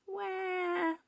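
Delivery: put-on very high, long drawn baby wail. A narrator's reading. Baby ghost on the step wants feeding, high wail. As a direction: cry like a newborn.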